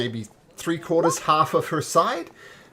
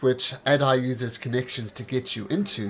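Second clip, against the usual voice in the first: ordinary delivery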